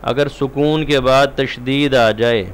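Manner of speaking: normal talking voice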